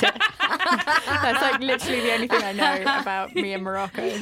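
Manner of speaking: laughing while telling it. Real, not acted. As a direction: speak through giggles. Laughing at own story.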